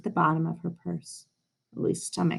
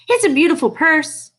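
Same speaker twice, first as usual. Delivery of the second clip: bright teacher voice, higher and louder; Mister Slinger; 9 semitones higher, 7 dB louder than usual. A narrator's reading. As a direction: play the kind teacher, praising. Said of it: "said Mister Slinger".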